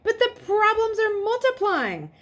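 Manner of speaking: high, loud urgent protest; pressing, desperate. A narrator's reading. Continuing protest.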